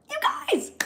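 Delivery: very high squeaky cry of joy; excited squeal. Real, not acted. Streamer to viewers over record, squealing. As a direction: squeal it out in disbelief. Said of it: "Okay, sorry".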